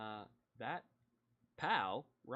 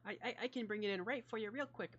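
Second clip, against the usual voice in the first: very high, put-on lady's voice; lady from the back; 11 semitones higher than usual. Judy answering the sheriff from the back, high-voiced lady. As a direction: pipe up eagerly in a high falsetto. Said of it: "you hear a lady from the back go".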